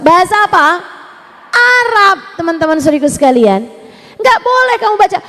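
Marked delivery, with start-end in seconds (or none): none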